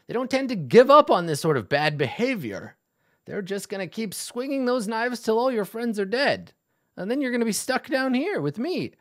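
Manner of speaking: put-on high, menacing old dwarf urging; old dwarf pressing. Dwarf NPC pressuring the player.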